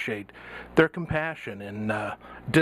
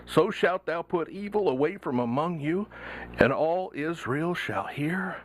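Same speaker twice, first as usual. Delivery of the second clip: rising impassioned oratory; 6 semitones higher than usual. Pretend. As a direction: preach it from the pulpit with fire. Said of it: "in your best preacher voice".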